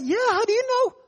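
high-pitched amazed question; retold astonishment. Man with knee pain to pastor, astonished. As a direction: ask it as if caught out, amazed.

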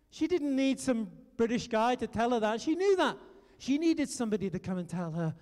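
ordinary talk

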